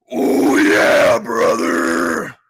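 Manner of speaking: high raspy wrestler growl, loud; loud raspy wrestling promo voice; Macho Man Randy Savage. Pretend. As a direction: go full Macho Man, oh yeah brother. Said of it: "I can do a pretty good Macho Man Randy Savage".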